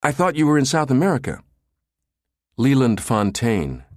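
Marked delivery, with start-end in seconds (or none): 0.0-1.4 fast, high-pitched fluster; flustered stammer. A narrator's reading. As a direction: blurt it out nervously. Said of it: "Brinkerhoff stammered".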